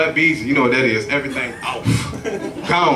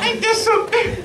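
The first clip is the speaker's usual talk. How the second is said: squeaky disbelief. Real, not acted. Exasperated exclaim.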